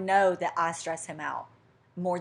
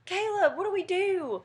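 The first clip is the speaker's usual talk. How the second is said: helpless plea; 13 semitones higher than usual. Retold or imitated. Woman to fiance after the office shuts, helpless plea. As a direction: whine it up high, acting helpless.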